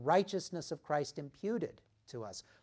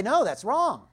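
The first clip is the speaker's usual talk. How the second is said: loud, higher indignant objection; mock protest; 8 semitones higher, 10 dB louder than usual. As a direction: blurt it out like a shocked listener. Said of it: "And you want to say".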